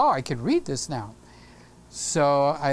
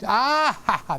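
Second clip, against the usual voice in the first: Sammy; 4 semitones higher than usual. Retold or imitated. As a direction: burst out with a mock "ah, ha, ha". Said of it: "that's a Sammy laugh".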